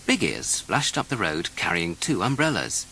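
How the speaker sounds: ordinary delivery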